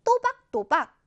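또박또박 high and distinctly separated. Shown as a demonstration. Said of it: "어떻게 읽어라?". ("또박또박"을 높고 분명하게)

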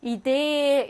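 이대 with its last vowel held long. Shown as a demonstration. Teacher demonstrates long vowels for students, 이대 drawn out. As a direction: stretch the end of 이대 slowly. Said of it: "이렇게 길게 발음하시면 됩니다".

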